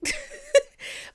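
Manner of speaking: high, loud laugh breaking out; real burst of laughter. Real, not acted. Laughing at mixing up a tweet and Khloe, real burst of laughter. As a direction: laugh at her own slip-up.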